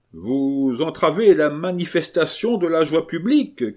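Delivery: high, loud echoing proclamation; Carmagnole. A narrator's reading. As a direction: cry it out pompously to the whole street. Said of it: "cria à son tour Carmagnole".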